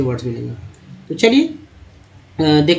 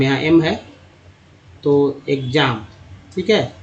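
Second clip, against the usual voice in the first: ordinary talk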